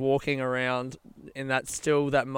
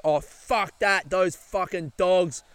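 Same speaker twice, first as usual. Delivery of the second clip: higher, slow, drawn-out imitated outrage; mock indignant; 4 semitones higher, slower than usual. Lady siding with the robbed man, imitated indignation. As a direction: curse the thieves on a friend's behalf. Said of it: "turned into being like".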